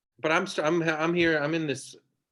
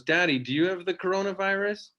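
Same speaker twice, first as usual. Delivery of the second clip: put-on higher, small child asking; 6 semitones higher than usual. Four-year-old daughter asks dad, lightly voiced child. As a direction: voice a little girl. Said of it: "she goes".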